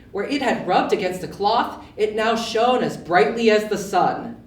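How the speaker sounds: ordinary delivery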